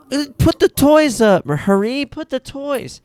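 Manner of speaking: put-on very high toddler voice, slow and bossy; imitating little girl. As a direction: mimic a small child giving orders. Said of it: "And then Bella is just like".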